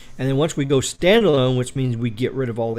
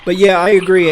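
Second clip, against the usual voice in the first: emphatic agreement; 6 semitones higher, 11 dB louder than usual. Real, not acted. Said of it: "I agree with your statement one hundred percent".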